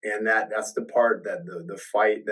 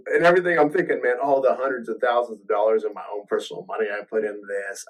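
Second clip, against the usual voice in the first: ordinary talk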